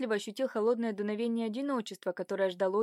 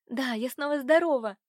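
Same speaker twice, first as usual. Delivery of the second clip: higher, louder cry of delight; joyful exclamation; 4 semitones higher, 5 dB louder than usual. A narrator's reading. Girl finding she is well again, joyful exclamation. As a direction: exclaim it, lifting into the last word. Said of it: "воскликнула девушка".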